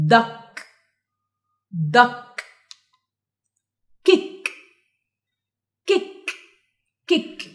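"Duck" and "Kick" short, final k released separately; model pronunciation. Shown as a demonstration. Teacher giving examples of C K, crisp isolated word drill. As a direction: click the last k in "Duck" and "Kick". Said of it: "صوتهم بيبقى عامل كده".

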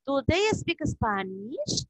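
slow question, "Spanish" rising at the end; question intonation. Shown as a demonstration. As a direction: draw it out and lift "Spanish" into a question. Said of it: "Cuando está el signo de pregunta". (entonación de pregunta, lenta, subiendo en "Spanish")

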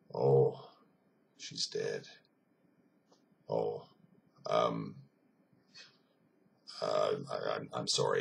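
low, hushed, halting sympathy; softened, melted. Retold or imitated. Russian soldier learns the man's wife is dead, softened and sorry. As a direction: let it sink in quietly, with gaps between phrases. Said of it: "kind of melted".

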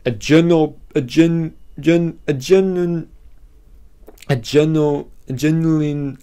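genuine stumbled, high and halting; struggling to say genuine. Real, not acted. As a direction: keep tripping on genuine. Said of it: "eh, I can't say that".

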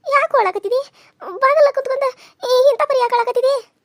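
high, anxious questioning of her son; worried mother. Acted. (ಮಗನನ್ನು ಆತಂಕದಿಂದ ಎತ್ತರದ ದನಿಯಲ್ಲಿ ಕೇಳುವುದು)

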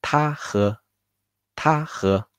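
clear, careful Mandarin 他喝 with a high level first tone; 示范发音 他喝; Chinese model phrase. Shown as a demonstration. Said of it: "He or she drinks will be".

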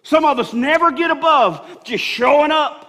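building sermon shout ending in a laugh; full-force preaching. Acted. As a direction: preach it hard to the congregation.

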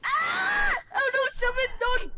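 high panicked shout; real alarm at a teammate's danger; panicked cry. Real, not acted. Teammate about to die in game.

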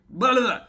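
loud, high babble at own slip; garbled blah-blah. Real, not acted. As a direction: babble out the flub in frustration. Stumbles over a name.

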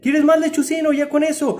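high, loud doting question; excited pet talk. Real, not acted. Asking the bird if it's full.

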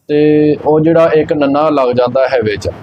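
normal talking voice

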